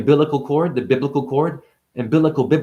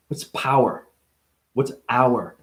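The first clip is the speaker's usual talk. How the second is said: normal talking voice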